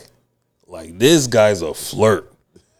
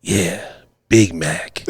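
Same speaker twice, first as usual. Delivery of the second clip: low, lightly imitated old player; lightly voiced Mister Johnson; 5 semitones lower, much slower than usual. Older patient confirming his nickname, lightly put-on voice. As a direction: confirm it like an old smooth talker. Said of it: "let me get the voice down properly".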